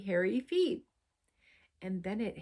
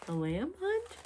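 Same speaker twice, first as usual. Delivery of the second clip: much higher, baffled squeak; small sheep, high and confused; puzzled question; 8 semitones higher than usual. Acted. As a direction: echo it in high bewilderment. Utopia puzzled reply.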